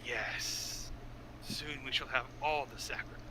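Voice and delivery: low, soft, dry raspy whisper; dry sandpaper on a faint wind; skeleton voice. Acted. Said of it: "It's very low and goes".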